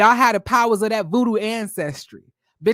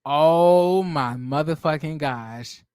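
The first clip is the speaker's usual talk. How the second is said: stunned; 6 semitones lower, much slower than usual. Real, not acted. Realizing he wrote the post.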